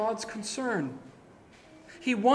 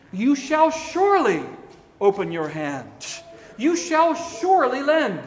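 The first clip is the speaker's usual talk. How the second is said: SURELY stressed, higher and louder; emphatic rendering; 3 semitones higher, 5 dB louder than usual. Shown as a demonstration. Preacher rendering the verse with force. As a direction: press hard on "surely". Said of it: "it is better captured thus".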